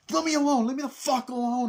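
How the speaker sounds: high, loud hammed-up plea to be left alone; mock frantic yell. Michael yelling at the bully to leave him alone, high mock shout. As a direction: shriek it like a cornered kid.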